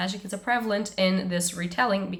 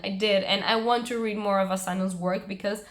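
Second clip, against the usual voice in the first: ordinary delivery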